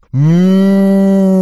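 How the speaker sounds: long loud bellowing moo; bull moo. A narrator's reading.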